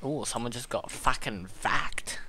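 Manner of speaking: slow hushed whisper; low whisper so nobody hears. Real, not acted. Gamer whispering a kill report at night.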